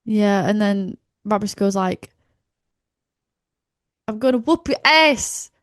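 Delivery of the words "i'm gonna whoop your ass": put-on American accent. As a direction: imitate an American wrestler's accent. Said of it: "I love doing that accent".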